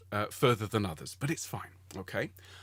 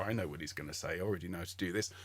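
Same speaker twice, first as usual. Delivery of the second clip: lower, softer mock know-it-all voice; smug and superior; 7 semitones lower, 7 dB quieter, faster than usual. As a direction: play the smug pupil who knows it all. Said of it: "feeling smug and superior".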